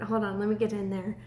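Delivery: ordinary talk, nothing marked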